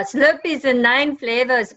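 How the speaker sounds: a light, badly faked Indian accent; fake Indian accent. Guest showing off her bad Indian accent, light mock Indian accent. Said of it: "an Indian accent that I do".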